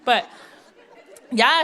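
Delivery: ordinary delivery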